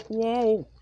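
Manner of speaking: high loud cry of shock; startled whoa. Acted. Ethan being pushed off the rails, loud shocked cry. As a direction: yelp as the engine tips over.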